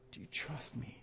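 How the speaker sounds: soft whispered question; God to Moses, a whispered question. Acted. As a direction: breathe it as God's gentle ask. God asking Moses gently.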